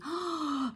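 sharp intake of breath in alarm; acted gasp of worry; shocked gasp. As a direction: gasp as if the baby might die. Reacting to a very sick baby.

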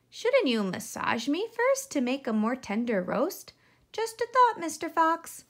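high, coaxing little pig voice; sweet piglet making a suggestion; crafty piglet. Acted. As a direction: talk the fox out of eating you. Piglet voice in story.

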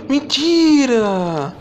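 long drawn-out high cry; disbelieving cry. Real, not acted. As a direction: stretch it out in disbelief. Caught by monster, drawn-out cry.

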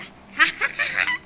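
high, loud staccato cackle; gloating laugh. Real, not acted. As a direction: laugh high in triumph over a beaten foe. Gloats over a doomed dragon. (ऊँची, ज़ोरदार विजयी हँसी)

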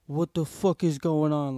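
loud mock confused outburst; acted bewilderment. Waking after passing out, loud bewilderment. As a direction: come to, loudly confused.

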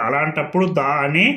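ordinary delivery

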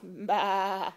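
wavering sheep baa; playful imitation of a sheep; sheep bleat. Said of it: "What's a sheep say?".

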